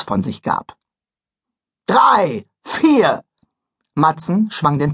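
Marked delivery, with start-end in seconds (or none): 1.9-3.2 counting in loudly. Acted. Conductor counts in.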